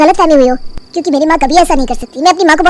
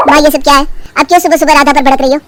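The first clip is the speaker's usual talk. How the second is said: usual speaking voice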